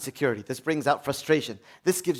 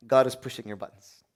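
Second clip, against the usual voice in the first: usual speaking voice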